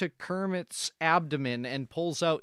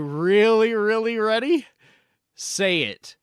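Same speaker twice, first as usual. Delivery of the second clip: louder push; 5 semitones higher, 7 dB louder than usual. Acted. Pressing for the big secret.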